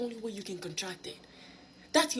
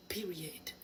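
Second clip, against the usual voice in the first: whispered; 9 dB quieter than usual. Real, not acted. Punchline after repeated advice.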